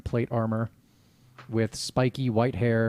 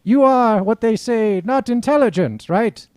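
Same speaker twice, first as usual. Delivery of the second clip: grand, high-pitched theatrical proclamation; pompous self-declared god; 12 semitones higher, 11 dB louder than usual. Acted. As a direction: declaim it like a deluded deity, full of self-importance. Plays self-declared god Tyrannus.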